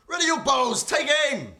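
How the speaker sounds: high, booming battlefield command; sergeant's order. Acted. Sergeant ordering his archers to aim, shouted command. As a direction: bellow it to the troops like a sergeant. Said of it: "the sergeant".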